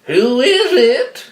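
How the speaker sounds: high, slow, loud granny falsetto; wolf's grandma voice. A narrator's reading. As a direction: put on a fake old-lady call. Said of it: "in his best grandma voice".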